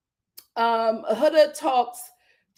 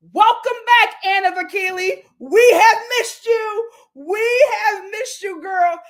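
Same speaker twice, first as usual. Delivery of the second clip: excited cheer; 8 semitones higher, 6 dB louder than usual. Real, not acted. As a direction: cheer at the screen. Host cheering at TV.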